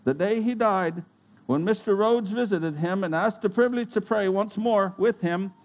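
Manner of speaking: ordinary delivery, nothing marked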